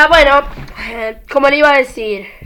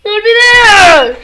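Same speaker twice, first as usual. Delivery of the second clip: frustrated cry; 6 semitones higher, 5 dB louder, slower than usual. Real, not acted. Realizes mistake, cries out.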